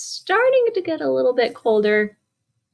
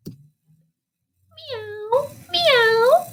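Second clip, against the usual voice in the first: high rising meows; high drawn-out cat meows. Acted. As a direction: meow like a lazy cat. Cat sound in picture book.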